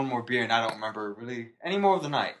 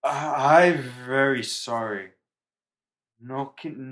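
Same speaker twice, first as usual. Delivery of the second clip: put-on slurred broken drunk English. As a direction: read it like a sloppy drunk. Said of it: "In, in drunk voice".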